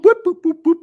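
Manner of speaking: very high, loud boops; mock car boop. Host imitating a rolling stop with high loud boops. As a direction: bounce the boops up like a toy horn.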